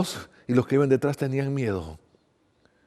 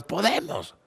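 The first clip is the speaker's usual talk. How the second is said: eager, higher-pitched "we can"; respuesta resuelta; 6 semitones higher, much slower than usual. A narrator's reading. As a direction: answer keenly as the disciples, pitch jumping up. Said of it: "Ellos contestaron". (respuesta entusiasta, en tono agudo)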